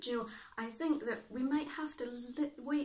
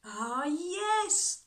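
higher, louder cry of realisation; 8 semitones higher, 6 dB louder than usual. A narrator's reading. Annie realises who she needs next, crying out. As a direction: call it out as if it just struck you. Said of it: "Annie cried".

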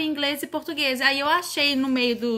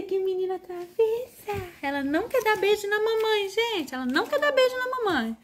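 high, sing-song mock hurt; mock complaint; 7 semitones higher than usual. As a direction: play up the hurt of a refused kiss, high. Mother mock-complains, kiss refused. (mãe finge mágoa com a filha, em voz aguda e cantada)

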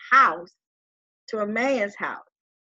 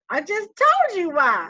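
exasperated; 8 semitones higher, 4 dB louder, slower than usual. Retold or imitated. Exasperated reply to cousin.

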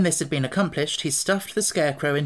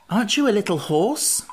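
higher-pitched, mildly polite question; 6 semitones higher, 3 dB louder than usual. A narrator's reading. Pumpkinhead asking the steed, lightly voiced and polite. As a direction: ask politely in a lifted character voice. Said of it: "asked the Pumpkinhead politely".

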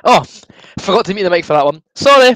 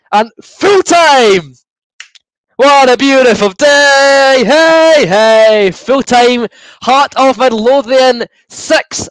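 announcer call; 4 semitones higher, much slower than usual. Pretend. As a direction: proclaim full time like a stadium announcer. Announcing full time result.

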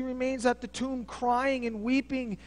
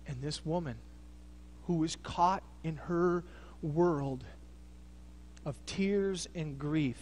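softer and lower, halting with pauses; hushed preaching; 5 semitones lower, 5 dB quieter than usual. Acted. Preacher imagining Mary's confusion, hushed and low. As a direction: wonder aloud, quietly.

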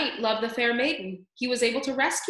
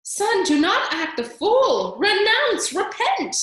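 frantic mother; 11 semitones higher, 7 dB louder, slower than usual. A narrator's reading. Mother pleads with son.

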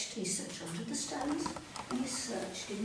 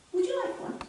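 eager frog; 13 semitones higher, 5 dB louder, faster than usual. Acted. Freddy offers fly.

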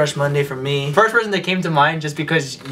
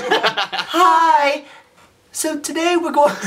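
high-pitched put-on greeting; pitched-up YouTuber impression, breaking into laughter; 9 semitones higher, much slower than usual.

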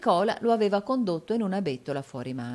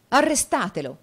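high, loud cry to stop someone; exclaimed call; 6 semitones higher, 7 dB louder than usual. A narrator's reading. Newman chasing his friend, exclaimed call. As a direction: call out while giving chase. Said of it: "esclamò Newman, inseguendolo".

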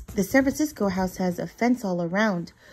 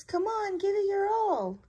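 much higher little mouse voice; squeaky cheering; 11 semitones higher than usual. Acted. Marvin cheers Tiny on at the wall, high and squeaky. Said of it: "Marvin squeaks".

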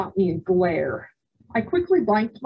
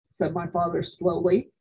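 usual speaking voice

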